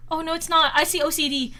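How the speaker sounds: ordinary talk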